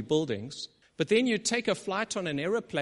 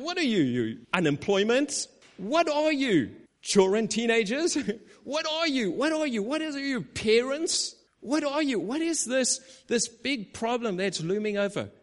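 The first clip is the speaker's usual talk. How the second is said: high, loud, mocking questions; preacher mocking his mountain; ridiculing; 6 semitones higher, 3 dB louder than usual. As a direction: taunt the problem like it's small. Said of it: "almost like ridiculing the mountain".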